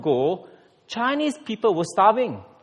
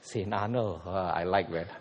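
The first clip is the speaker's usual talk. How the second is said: savoring title; 11 semitones lower, 7 dB quieter than usual. Pretend. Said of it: "Nice ring, huh?".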